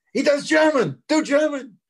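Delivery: very high, loud urging; mock shouted urging. Lee selling the actor to the producers, high excited shout. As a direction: cry it out high, pushing the actor on.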